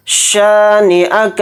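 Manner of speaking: शानिअक with a broad शीन and a stretched शा; full sheen. Shown as a demonstration. Teacher demonstrating a full शीन, slow. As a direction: open the शीन of शानिअक wide and draw out the शा. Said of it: "अच्छे से बड़ा शीन निकालेंगे".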